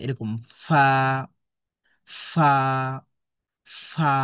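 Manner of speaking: ফা with a light breath of air through lip and teeth; airy fa. Shown as a demonstration. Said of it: "মুখ দিয়ে হালকা বাতাস বের হবে". (ফা হালকা বাতাস ছেড়ে উচ্চারণ)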